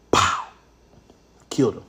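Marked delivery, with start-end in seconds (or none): 0.1-0.5 sudden punchy vocal gunshot; short, explosive "pow"; imitated gunshot. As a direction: mimic a single gun blast by mouth.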